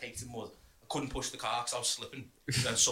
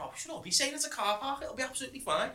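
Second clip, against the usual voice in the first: much higher-pitched retold voice; mock reassurance; 12 semitones higher than usual. Talking a mate into the car park, mock high-pitched reassurance. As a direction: imitate yourself breezily insisting, voice pitched right up. Retold breezy persuasion of nervous driver.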